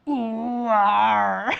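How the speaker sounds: loud, drawn-out pirate farmer growl. Acted. Host delivering a pirate-and-farmer punchline, hammed-up pirate farmer. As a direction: swell into a big rustic pirate cry. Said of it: "cross a pirate with a farmer".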